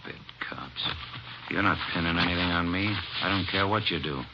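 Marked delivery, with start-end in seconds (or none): 0.0-0.9 whispered under the breath; muttered aside. Acted. Suspect muttering about the cops, whispered contempt. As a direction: mutter it to yourself, sneering.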